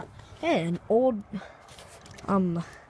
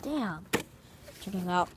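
ordinary delivery

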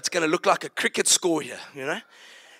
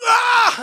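short, high, loud cry; choked yell; 6 dB louder than usual. Retold or imitated. Grabbing the big guy's throat, a high sharp yell. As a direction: let out a sudden pained yell. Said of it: "and I just went".

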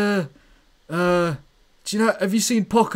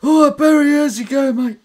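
high, loud, cocky geezer calling out; Schwartz; 4 semitones higher, 4 dB louder than usual. Pretend. Schwartz takes Barry's question, high loud swagger. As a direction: taunt him in a raised, matey voice. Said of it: "Can I ask a question for Schwartz?".

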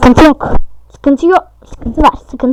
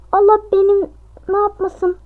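ordinary talk

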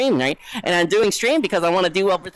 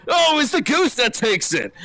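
mock commentator; 6 semitones higher than usual. Commentating ducks and geese like a race.

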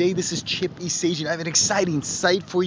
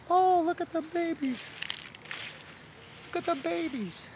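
cooing at ducklings; 13 semitones higher, 7 dB quieter than usual. Real, not acted.

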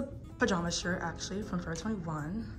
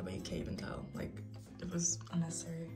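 whispering; 3 semitones lower, 8 dB quieter, faster than usual. Real, not acted. Aside about the eyeshadow.

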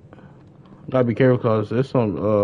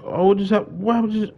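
startled; 8 semitones higher than usual. Real, not acted. Streamer reacting to something sudden in the game, startled. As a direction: pitch jumps higher in sudden surprise.

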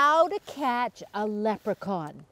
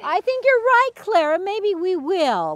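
bright; 6 semitones higher, 5 dB louder than usual. Real, not acted. Cheerful reply to child.

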